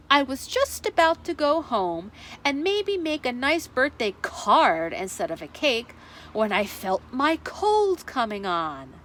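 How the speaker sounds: plain reading voice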